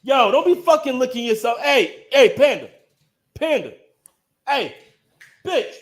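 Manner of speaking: high, loud scolding; calling out, scolding. Real, not acted. Owner calling the dog to stop, loud scolding. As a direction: shout at a pet to cut it out.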